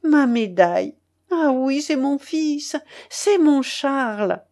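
exclaim, drawing out the final name; joyful exclamation. A narrator's reading. Mother recognising her son by his medal, exclaiming joyfully. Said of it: "s'écria-t-elle".

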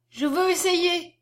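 high, slow, deliberate declaration; a wilful child insisting; determined little girl. A narrator's reading. Little girl declares her wish.

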